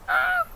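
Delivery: high falsetto squeal; hammed-up falsetto yelp; mock shriek. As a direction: squeak out a fake scream. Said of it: "I was just, like, mimicking".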